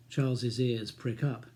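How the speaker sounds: ordinary delivery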